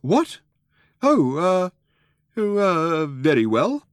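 put-on high, halting old man's surprise; slightly taken aback. A narrator's reading. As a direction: stumble over the words, caught off balance. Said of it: "slightly taken aback".

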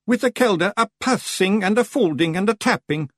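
high, ominous Scottish warning; doom-laden Rob Anybody. A narrator's reading. Rob frightening the Feegles, voice of doom. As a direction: pronounce it like impending doom. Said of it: "in a voice of doom".

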